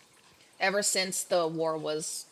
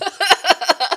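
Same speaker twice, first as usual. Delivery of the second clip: loud high laugh breaking out; loud giggling fit; burst of laughter; 12 semitones higher, 16 dB louder than usual. Real, not acted. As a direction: crack up at your own voice. GM laughs at own voice attempt.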